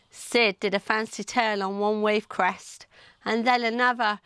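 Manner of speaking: plain reading voice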